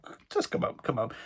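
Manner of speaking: soft whispered inner voice; whispered thought. Retold or imitated. Vlogger thinking while letting people up, whispered. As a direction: say it under your breath, kindly. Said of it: "and I thought".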